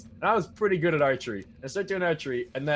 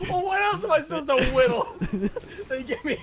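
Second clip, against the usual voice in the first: high, laughing through the words; cracking up while talking; 12 semitones higher, 4 dB louder than usual. Real, not acted. Laughing about prison joke.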